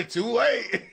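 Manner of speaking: high, loud burst through laughter; laughing. Real, not acted. Streamer amused his friend's message already aired, laughing. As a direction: blurt it out while cracking up.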